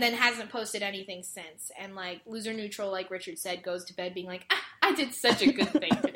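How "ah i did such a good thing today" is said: mock self-satisfaction. Mocking the character's smug bedtime thought.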